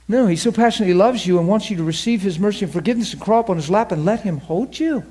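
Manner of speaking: ordinary delivery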